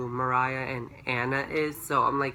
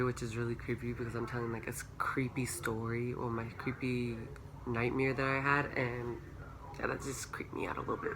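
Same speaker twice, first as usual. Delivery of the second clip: spooked whisper; 3 semitones lower, 12 dB quieter than usual. Real, not acted. Spooked aside about camera cutting off.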